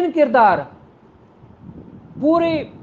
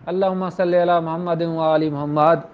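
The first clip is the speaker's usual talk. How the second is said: devout, lowered recitation of the salawat; recited blessing on the Prophet; 6 semitones lower than usual. Real, not acted. Lawyer explaining a case to students pauses to recite the durood, lowered and devout. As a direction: drop low and intone it like a prayer.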